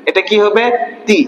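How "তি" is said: তি said short and straight; a quick, brief তি; short unstretched vowel. Shown as a demonstration. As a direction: keep the vowel of তি brief, no stretching. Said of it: "এটা আসলে আমরা কিভাবে পড়বো?".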